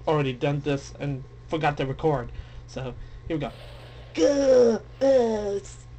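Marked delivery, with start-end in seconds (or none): 4.1-5.9 strangled gasping grunts; hammed-up gagging creature; creature gasps. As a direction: choke out the sounds like a crawling monster. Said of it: "says legless girl".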